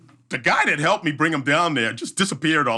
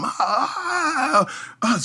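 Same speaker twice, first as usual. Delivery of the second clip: high mock cry of pain, much higher; imitating pained cry; 14 semitones higher than usual. Injured runner cries out as his knee pops. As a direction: imitate the injured man's yelp. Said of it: "you hear him".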